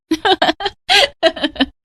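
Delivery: high, tinkling giggle; giggling laugh. Acted. Sister-in-law teasing her brother-in-law on the phone, giggling. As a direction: burst into a playful, high giggle. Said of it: "Dimple खिलखिलाकर हंस दी".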